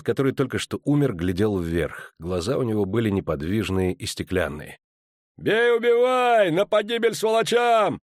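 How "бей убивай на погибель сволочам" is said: very high, loud, slow roar; dwarf roars at his ranks; roaring battle cry. A narrator's reading. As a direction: roar the charge at the troops. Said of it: "рычал Барклай Эльс".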